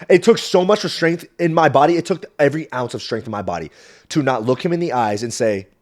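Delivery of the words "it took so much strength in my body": usual speaking voice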